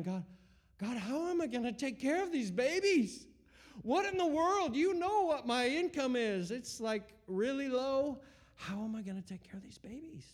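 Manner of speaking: high, pleading cry to God. Retold or imitated. Father in the shower pleading with God, high anguished cry. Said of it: "I'm just crying out to God".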